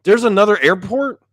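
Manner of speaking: loud, high incredulous cry; retold freak-out. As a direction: re-enact panicked disbelief at full pitch. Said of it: "I'm freaking out at this point".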